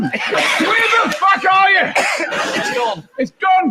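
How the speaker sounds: high, slow, shouted rant. Acted. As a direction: rage like Brian Blessed. Ranting shout.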